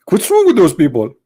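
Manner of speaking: usual speaking voice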